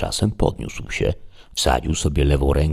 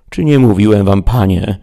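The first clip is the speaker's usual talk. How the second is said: higher, louder reproachful squire; Sancho the squire; 6 semitones higher, 7 dB louder than usual. Acted. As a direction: scold your master like a fretful servant. Squire Sancho scolds his master.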